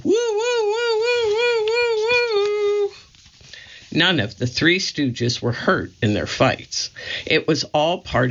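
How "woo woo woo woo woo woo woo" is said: woo woo hooted much higher; high silly hoots; hooting. Acted. Curly running down the hospital hall, high hooting woos. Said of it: "ran down the hall hooting".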